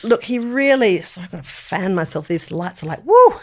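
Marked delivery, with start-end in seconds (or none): 3.0-3.3 sudden high, loud woo; hot woo. Real, not acted. Host fanning herself under studio lights, whooping. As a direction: let out a whoop at the heat.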